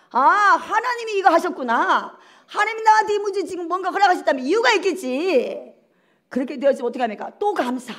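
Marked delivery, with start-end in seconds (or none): 0.1-5.7 higher, louder musing acceptance; acted trusting realization. Believer accepting a problem from God, trusting exclamation. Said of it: "마음이 평안함이 옵니다".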